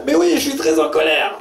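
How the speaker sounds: high-pitched hammed-up indignation; mock furious disciple. Translator voicing the disciple, hammed-up high fury. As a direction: squeal the anger, comically. Disciple admits being angry.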